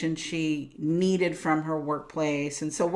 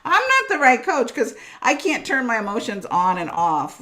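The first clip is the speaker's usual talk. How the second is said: higher, louder indignant comeback; indignant retort; 6 semitones higher, 6 dB louder than usual. Retold or imitated. Telling the area manager she can't switch emotions off, indignant higher louder retort. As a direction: fire back at the boss, higher and louder.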